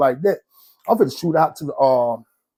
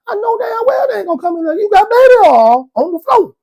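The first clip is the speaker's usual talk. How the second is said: exasperated wail; 5 dB louder than usual. Retold or imitated. Outburst at cellmate.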